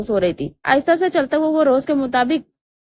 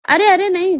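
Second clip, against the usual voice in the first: sudden higher, louder protest; worried chirp; 6 semitones higher, 4 dB louder than usual. A narrator's reading. Mother stopping Danyal from leaving tonight, sudden fond alarm. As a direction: jump in quickly, anxious but fond. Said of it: "ایک دم فکر مندی اور محبت سے چہکتے ہوئے کہا".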